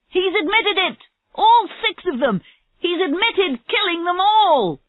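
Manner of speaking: high, elated cry of triumph; excited announcement. Acted. Jones tells the waiting officers he's admitted killing them, excited. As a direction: burst in with huge news, pitched right up. Officer bursts in with breakthrough news.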